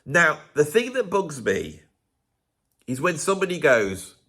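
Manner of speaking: normal talking voice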